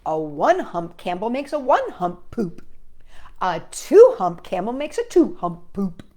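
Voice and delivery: lively read-aloud voice for little listeners; reading to children. A narrator's reading. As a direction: read it like a bedtime picture book. Reading picture book to children.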